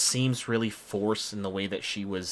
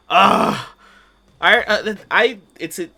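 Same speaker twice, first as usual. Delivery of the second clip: loud, high frustrated groan; 11 semitones higher, 15 dB louder than usual. Real, not acted. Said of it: "this is gonna bother me now".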